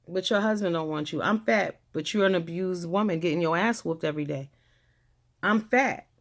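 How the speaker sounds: ordinary delivery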